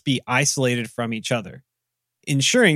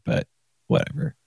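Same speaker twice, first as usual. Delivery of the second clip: normal talking voice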